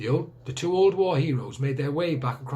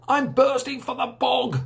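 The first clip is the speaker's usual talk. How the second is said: higher, louder, slow angry shout; 11 semitones higher, 5 dB louder than usual. Acted. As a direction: bellow it out, fed up and desperate. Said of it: "shouted Missus Trifle angrily".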